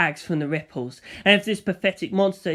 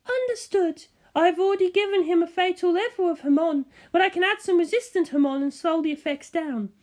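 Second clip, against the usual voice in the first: a much higher woman's voice, composed and kind; Elizabeth's voice; 11 semitones higher than usual. Acted. Elizabeth radioing the narrator with a kind, higher woman's voice. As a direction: answer gently but firmly over the radio.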